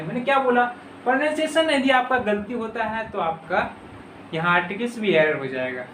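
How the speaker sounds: ordinary talk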